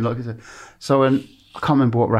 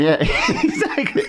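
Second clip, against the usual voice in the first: laughing. Real, not acted. Agreeing with a co-host's joke, laughing hard. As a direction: burst of laughter, high and slow.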